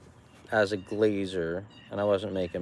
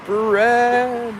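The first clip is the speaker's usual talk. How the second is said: higher, louder hawker's call; 12 semitones higher, 7 dB louder than usual. Acted. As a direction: cry it out like a market seller. Calling out to customers.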